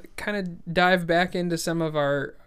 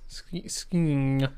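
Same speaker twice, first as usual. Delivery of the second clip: lips barely moving; 2 semitones lower than usual. Shown as a demonstration. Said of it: "the M at the end that makes it hard".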